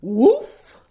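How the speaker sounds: loud single dog bark; firm bark. Acted. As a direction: bark firmly like a stubborn dog. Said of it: "barked Brute firmly".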